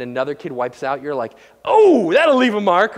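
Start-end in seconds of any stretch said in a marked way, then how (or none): 1.6-3.0 loud, high, drawn-out "oh" of amused sympathy; mock wincing reaction. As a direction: play a bystander wincing at someone's tumble. Mock reaction to kid wiping out.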